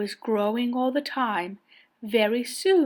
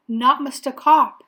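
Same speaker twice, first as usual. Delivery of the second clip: ordinary delivery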